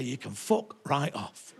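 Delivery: a low, soft, slow fuck right off; deadpan drop it to a murmur; quiet slow retort. Retold or imitated. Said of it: "I could say".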